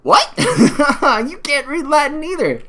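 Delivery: high, loud laughing disbelief; incredulous laughter. Real, not acted. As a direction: crack up, then slowly throw the taunt back. Mocking the clip's Latin claim.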